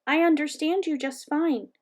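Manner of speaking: high-pitched, urgent hamster voice; frantic squeak. A narrator's reading. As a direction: insist in an anxious high squeak. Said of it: "I frantically squeaked".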